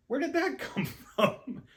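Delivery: very high, slow, laughing disbelief; amazed question. Retold or imitated. Friend turning to speaker, astonished at his Italian, high laughing disbelief. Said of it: "my friend just turns to me and goes".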